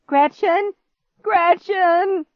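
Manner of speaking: high, loud repeated cry; frantic calling. A narrator's reading. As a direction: shout the name excitedly. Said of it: "his voice grew higher, more excited".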